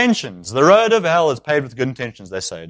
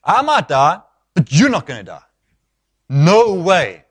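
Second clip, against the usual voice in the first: acting out Peter's heated refusal, pitched up; passionate, loud protest; 6 semitones higher, 6 dB louder than usual. Peter refusing to let Jesus die, passionate protest. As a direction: dig in loudly, refusing to allow it. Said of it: "Peter's probably quite passionate, so he says it quite loudly".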